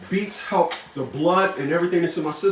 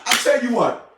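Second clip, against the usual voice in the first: ordinary delivery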